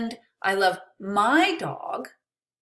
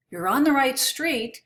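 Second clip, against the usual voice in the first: loud, higher call down a bad line; shouting over static; 5 semitones higher, 4 dB louder than usual. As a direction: raise your voice so it cuts through static.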